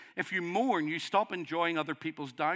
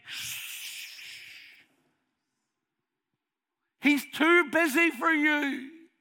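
shushing dismissal; 11 semitones higher, 3 dB louder, slower than usual. Retold or imitated. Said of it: "Jesus' disciples come and say".

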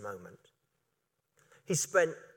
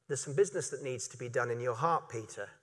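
softer, gentle words from Jesus to Peter; tender Jesus; 7 semitones lower, 9 dB quieter, faster than usual. Acted. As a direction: speak softly into Peter's heart.

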